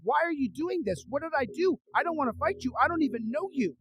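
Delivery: high, pleading voice; retold desperate plea; begging. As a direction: implore him, pitched well up. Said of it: "begging him".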